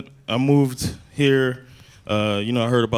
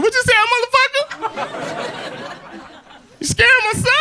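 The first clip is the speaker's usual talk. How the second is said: squeaky, very high faux-tough challenge; voice raised into questions. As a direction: tough talk gone falsetto, ending as questions. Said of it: "how your voice raises, everything I said was in questions".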